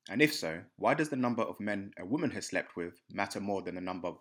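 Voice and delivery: low, soft host sign-off; podcast outro. Acted. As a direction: wrap up the show calmly. Host signs off the episode.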